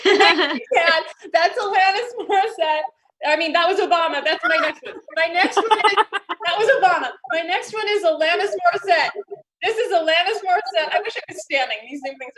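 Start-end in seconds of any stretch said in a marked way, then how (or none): none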